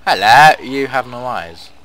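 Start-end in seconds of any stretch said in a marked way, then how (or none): 0.1-0.5 much louder call into the dark. Real, not acted. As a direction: shout it out to get an answer. Calling out to corpse loudly.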